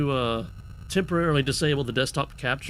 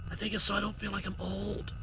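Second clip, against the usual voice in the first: whispering; 4 semitones higher, 9 dB quieter than usual. Real, not acted. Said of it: "Why are we whispering?".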